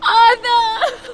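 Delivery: crying out his name through sobs, slow and drawn out; anguished sobbing cry. Acted. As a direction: wail in grief over her brother's body. Finds brother's tortured body.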